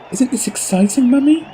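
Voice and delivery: put-on bright thrilled child voice; excited little girl. Little girl asks mother excitedly.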